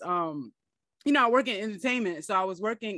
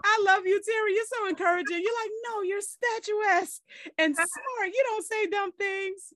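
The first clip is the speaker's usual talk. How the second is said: playful imitation of friend; 12 semitones higher than usual. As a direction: parody her hype, sweetly. Teasing friend's encouragement, imitated.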